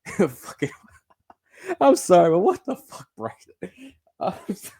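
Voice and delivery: high, loud words through laughter; cracking up, can't hold it in; laughing. Real, not acted. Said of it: "I shouldn't be laughing like this".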